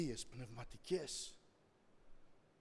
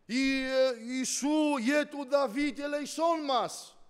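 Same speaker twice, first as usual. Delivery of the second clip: raised, drawn-out plea for mercy; crying out; 9 semitones higher, 11 dB louder, much slower than usual. Retold or imitated. Believers crying to Jesus for mercy, calling out. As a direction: call out high and slow to be heard. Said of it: "φωνάξαμε".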